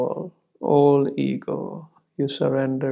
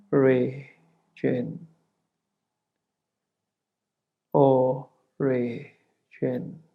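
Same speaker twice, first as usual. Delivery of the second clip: "O Re Jin" drawn out syllable by syllable, with gaps; chant-like slow repetition of "O Re Jin", each syllable set apart; slow rhythm; much slower than usual. Shown as a demonstration. Guide showing listeners the linkage word, in slow rhythm. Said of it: "I will repeat in slow rhythm".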